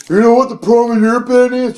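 high, loud drunk imitation; drunk heckler. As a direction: imitate a drunk guy butting in. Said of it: "Just drunk as shit".

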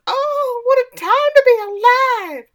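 put-on high, loud wailing old-lady voice; mock crying Dolly. Recapping the show, friend voices Dolly crying. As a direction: ham up a tearful cry of joy. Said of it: "Dolly starts crying and says".

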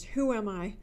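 ordinary delivery

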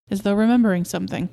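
usual speaking voice

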